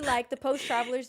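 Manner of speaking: ordinary delivery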